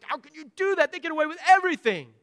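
put-on high-pitched indignant child's complaint; outraged sibling. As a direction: protest the unfairness, acting a jealous sibling. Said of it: "One child is looking at what the parents gave".

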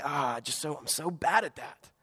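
soft, deflated sigh of defeat; mock self-pity. Preacher mimics a discouraged churchgoer, soft and deflated. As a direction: whine it like you've given up. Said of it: "'cause you're gonna say".

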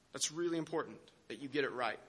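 low, soft voice of an imagined teacher; hushed admonition. Acted. Imagined Jesus telling followers to get it right, hushed admonition.

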